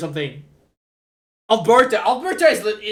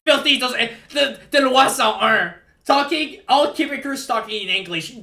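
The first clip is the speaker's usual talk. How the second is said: loud, higher-pitched mock indignation; mock outrage; 6 semitones higher, 6 dB louder than usual. Quebecer playfully rants about Anglophones, loud mock outrage. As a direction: rant with put-on indignation.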